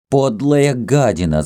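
high-pitched furious insult; angry cry. A narrator's reading. Jealous Greek to his lover Kama, enraged. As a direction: hurl it in rage, without full shouting. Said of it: "закричал грек".

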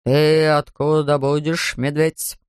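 a policeman questioning a bear; stern policeman. A narrator's reading. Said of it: "спросил он строго". (строго, неторопливо)

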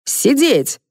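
high-pitched order, only mildly yelled; a storyteller's restrained version of yelling an order; yelled command. A narrator's reading. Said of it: "заорал Кеша".

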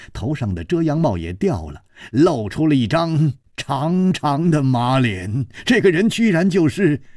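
plain reading voice

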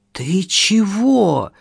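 suddenly hoarse, slow and catching. Acted. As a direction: voice gone hoarse from fright. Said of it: "внезапно севшим голосом".

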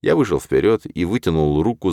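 usual speaking voice

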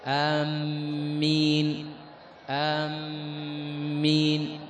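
آمين drawn slowly with the m doubled; doubled m amen. Shown as a demonstration. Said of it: "هذا هو التشديد". (آمين بتشديد الميم ببطء)

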